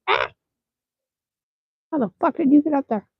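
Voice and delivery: high, fast startled squawk, imitating a buddy caught off guard; startled yelp. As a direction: act out a panicked friend, pitch up and rush it. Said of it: "and he'll be like".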